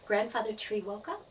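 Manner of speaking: ordinary delivery, nothing marked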